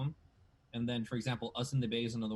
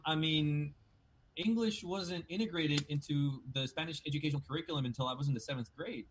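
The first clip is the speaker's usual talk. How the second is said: ordinary talk, nothing marked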